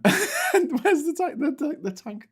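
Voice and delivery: squeaks it out through laughter, very high and slow; can barely get the words out for giggling; laughing search for the squeaky tank. Real, not acted.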